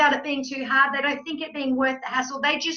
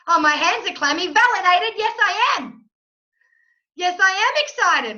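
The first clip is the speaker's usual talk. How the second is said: acted excitement; 4 semitones higher than usual. Coach acting out the brain validating its nerves, rising excitement. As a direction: build from nervous noticing to higher, triumphant affirmation.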